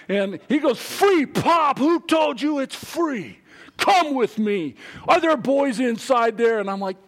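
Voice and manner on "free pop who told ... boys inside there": put-on man in suit telling off the boys, incredulous; indignant scolding. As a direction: scold the kids for stealing.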